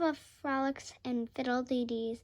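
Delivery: plain reading voice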